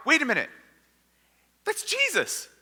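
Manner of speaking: high-pitched, startled double take; freaked-out realization. Acted. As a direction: jump up high in sudden disbelief. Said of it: "They are totally freaked out".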